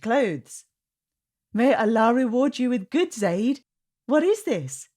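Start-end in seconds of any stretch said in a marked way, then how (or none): 1.5-4.9 higher, louder thrilled sister; light up at the gift; delighted. A narrator's reading. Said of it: "asked Layla".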